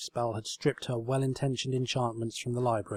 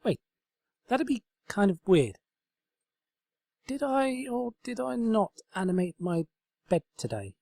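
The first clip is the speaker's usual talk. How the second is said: higher flustered pony voice; flustered realisation; 10 semitones higher than usual. A narrator's reading. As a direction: catch yourself mid-thought.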